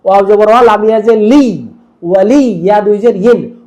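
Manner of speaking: ordinary talk, nothing marked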